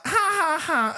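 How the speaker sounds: high, spelt-out mock laugh; imitated laughter. Host mimics the movie characters laughing together, mock laughter. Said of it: "and they're laughing".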